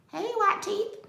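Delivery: high, squeaky greeting to her own teeth; mock cheerful hello; playful squeal.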